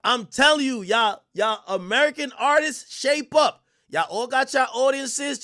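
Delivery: loud, high-pitched hype rant; emphatic hype. Real, not acted. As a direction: proclaim it loudly to hype up a star. Hyping an artist as a star.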